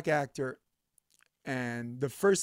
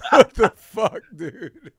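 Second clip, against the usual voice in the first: laughing; 7 semitones higher, 11 dB louder, much slower than usual. Real, not acted. Laughing at the scene.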